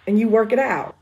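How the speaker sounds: short and to the point, a man's curt phrasing; blunt guy line. Shown as a demonstration. Author tries a man's blunt line for her character, loud. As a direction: say it like a dude, curt. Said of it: "Y'all would say something like that".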